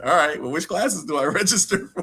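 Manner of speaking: high, amused, laughing mid-sentence; laughing through the question. Retold or imitated. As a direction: let the laugh break into the words. Retelling confused exchange, laughing.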